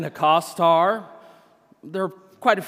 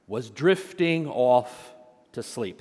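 ordinary talk

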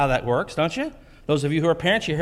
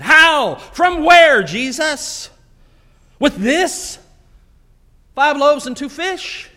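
exasperated protest; 9 semitones higher, 6 dB louder, slower than usual. Retold or imitated. Disciples protesting to Jesus.

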